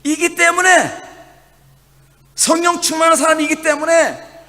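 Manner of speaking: impassioned pulpit cry; preaching at full force. Acted. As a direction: build the congregation up with each "때문에".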